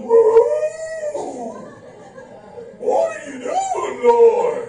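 high, loud cry of alarm at God; hammed-up wail of protest; mock panicked shout. Mocking a churchgoer shouting at God in panic.

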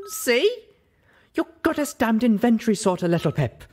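high, loud, sharp bark. Acted. Velvet Remedy barks at Little Pip, loud and sharp. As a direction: snap the words at her. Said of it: "Velvet Remedy barked".

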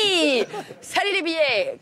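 high, loud mock hail; calling out a window. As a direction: holler a hammed-up greeting into the street. Opens window, calls out to money.

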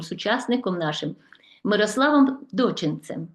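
ordinary talk, nothing marked

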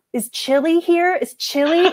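high-pitched call of Is chili here, Is chili; mock counter clerk. Clerk calling out the misheard name at pickup. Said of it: "and it was".

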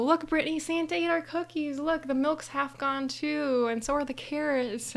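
high, excited discovery, acted; amazed child. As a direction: show your sister the evidence, thrilled. Acting child discovering Santa ate cookies.

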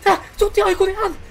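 high, loud, frantic cry; frantic shout. Real, not acted. Gamer shrieking when an Icon card shows up again.

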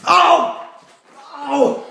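high, loud panicked yelling; yelling in pain. Acted. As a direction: shout in panic at the attacker. Slapstick fight, yelling.

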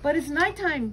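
high, anxious squeak. A narrator's reading. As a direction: squeak it out nervously. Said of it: "Stella Luna squeaked".

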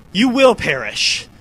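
slow, loud menacing read; dramatic villain line. Pretend. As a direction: boom it like a villain. Reading boss line dramatically.

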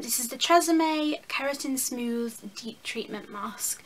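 slow, lightly put-on posh English accent. Vlogger reading a product name to viewers, mock posh English accent. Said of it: "I tried to say that in a posh accent".

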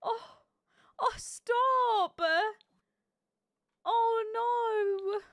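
high, tearful oh stop; choked-up, overwhelmed by sweetness; overcome aww. Real, not acted. Gamer overwhelmed by her sim's promise, high tearful oh stop.

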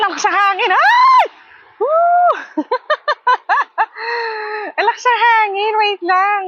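high excited shrieking; excited squeals. Real, not acted. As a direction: squeal as the wind shakes you. Windy duyan. (tili sa hangin)

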